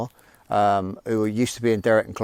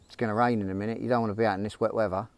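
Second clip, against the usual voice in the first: soft, quick voice in the head; lightly voiced nag, murmured fast; nagging inner voice; 2 semitones lower, 7 dB quieter, faster than usual. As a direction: talk them out of it, quietly and quickly. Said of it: "the voice says to me".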